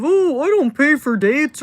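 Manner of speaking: high, loud mocking voice of a cheap guy; mocking whiny guy. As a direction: sneer it out like a stingy fan. Mocking cheap guys' excuses.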